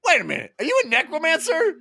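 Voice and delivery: very high-pitched mock disbelief; incredulous outburst. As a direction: squeal the question in exaggerated shock. Acted alarmed question from a story.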